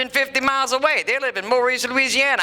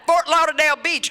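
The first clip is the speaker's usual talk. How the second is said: normal talking voice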